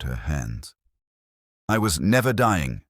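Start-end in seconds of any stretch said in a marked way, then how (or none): 1.7-2.8 announcing. A narrator's reading. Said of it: "she announced".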